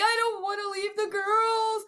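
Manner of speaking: high mock-crying wail; imitated crying. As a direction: imitate yourself bawling like a kid. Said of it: "And I would just cry".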